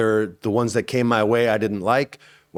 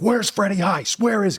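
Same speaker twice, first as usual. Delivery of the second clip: reliving his fury at the stunt coordinator; retold angry yelling; 11 semitones higher than usual. Actor fuming after a near miss, demanding the stunt coordinator, high angry call. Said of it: "and I'm, I'm pissed".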